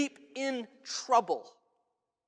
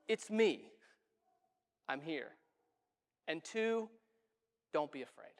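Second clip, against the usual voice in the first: soft, much quieter comforting words; gentle reassurance; 6 semitones lower, 9 dB quieter than usual. Acted. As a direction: speak it low and soothing, as if to calm fear. Jesus calming terrified disciples.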